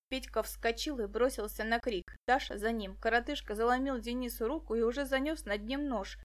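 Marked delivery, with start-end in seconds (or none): none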